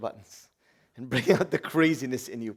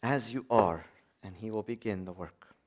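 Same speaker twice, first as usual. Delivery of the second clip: soft repetition; 6 semitones lower, 9 dB quieter than usual. Shown as a demonstration. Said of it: "Bring yourself to God".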